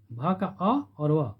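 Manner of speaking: भवन taken apart slowly as भ का अ और व; slow syllable demo. Shown as a demonstration. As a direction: slowly show the अ inside भ. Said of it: "औ में बदल गया". (भवन को धीरे-धीरे तोड़कर समझाना)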